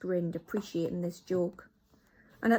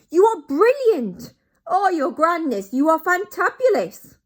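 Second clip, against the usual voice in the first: much higher, loud, fawning praise; fawning witches; 10 semitones higher, 14 dB louder than usual. Acted. Witches flatter the Grand High Witch, high loud fawning. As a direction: gush over the leader.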